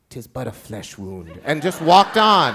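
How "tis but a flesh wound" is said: quiet deadpan line; shy girl quoting a film, deadpan and soft. Retold or imitated. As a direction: toss it off softly, unbothered. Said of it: "she looked at it and said".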